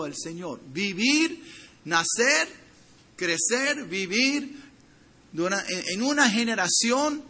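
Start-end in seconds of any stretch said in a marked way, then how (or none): none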